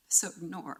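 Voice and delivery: ordinary talk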